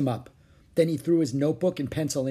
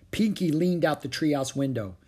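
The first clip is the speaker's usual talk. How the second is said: ordinary delivery, nothing marked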